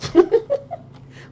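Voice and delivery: high loud gloating laugh; gleeful cackle. Pretend. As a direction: cackle like a thief getting away. Imagined thief laughing off.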